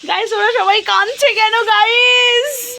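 high, slow hammed-up whimper; fake-sob the question to the camera; mock crying lament. Asking viewers why everyone cries in a mock sob.

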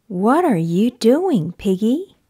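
usual speaking voice

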